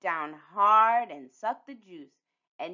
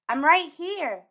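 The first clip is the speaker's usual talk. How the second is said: calling back; 9 semitones higher, 5 dB louder than usual. A narrator's reading. Child answering grandma's call.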